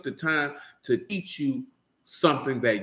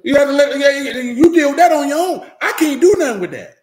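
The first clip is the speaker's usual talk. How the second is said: much louder and higher, brushing him off; loud laughing dismissal; 10 semitones higher, 9 dB louder than usual. Acted. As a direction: wave him away with a laugh. Telling the crying man off.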